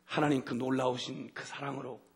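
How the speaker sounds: usual speaking voice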